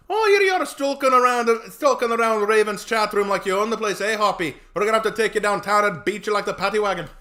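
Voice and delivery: loud, high-pitched Irish cop scolding; Irish accent. Pretend. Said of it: "If he sounded Irish".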